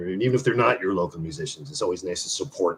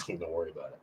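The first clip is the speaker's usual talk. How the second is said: smug hush; 5 semitones lower, 14 dB quieter, faster than usual. Retold or imitated. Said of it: "his face is going".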